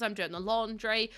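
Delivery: usual speaking voice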